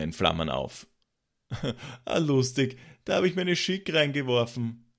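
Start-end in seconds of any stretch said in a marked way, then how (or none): 2.1-4.9 high-pitched tipsy amusement; drunken babble. A narrator's reading. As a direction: babble merrily like a drunk friend. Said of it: "labelte Freund Christoph".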